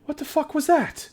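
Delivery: a startled dwarf jumping back. Acted. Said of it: "he kind of jumps back ... and goes".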